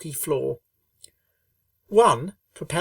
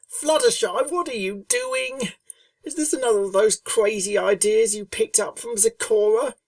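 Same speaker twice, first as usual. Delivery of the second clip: higher, brash tomboy voice, incredulous; Rainbow's voice; 7 semitones higher, 4 dB louder than usual. A narrator's reading. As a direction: demand it like a pushy friend. Rainbow voiced, puzzled and accusing.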